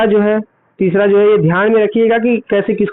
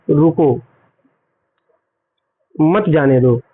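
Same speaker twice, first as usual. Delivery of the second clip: "रुको", long pause, then lower "मत जाने दो"; pause after रुको; 4 semitones lower, slower than usual. Shown as a demonstration. Teacher showing where a comma pauses, long break after रुको. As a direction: stop after रुको, say "मत जाने दो" as one phrase. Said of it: "सबसे कम समय रुकने के लिए".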